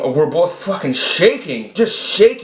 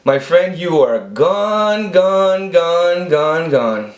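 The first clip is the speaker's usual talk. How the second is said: imitating the head-shaking boss, very slow and higher; mock boss verdict; 4 semitones higher, much slower than usual. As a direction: stretch out each gone like a boss savoring the firing. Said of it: "shaking his head going".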